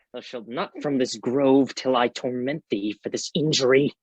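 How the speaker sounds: ordinary talk, nothing marked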